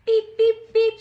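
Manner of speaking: loud high beeping horn; truck horn beeps. Acted. Truck honking at his friend, loud high beeps. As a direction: honk it out like a little truck.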